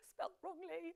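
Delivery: laughing through the words; amused laugh. Real, not acted. Quiz host spotting a misspelling, laughing. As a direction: laugh at a silly slip.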